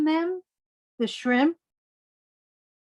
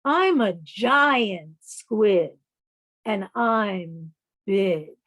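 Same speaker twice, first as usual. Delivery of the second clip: slow, proud boast of a big squid; boastful giant squid; slower than usual. Acted. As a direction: puff up and brag like a huge squid.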